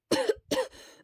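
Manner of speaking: dry hacking cough; harsh cough. Acted. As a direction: cough from a sore throat. Sick character coughing.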